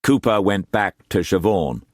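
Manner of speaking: plain reading voice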